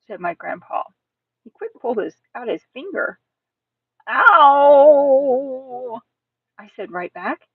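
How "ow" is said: long, loud, high yelp; drawn-out wail tailing away; cry of pain. Acted. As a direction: howl right back in pain. Child cries out in pain.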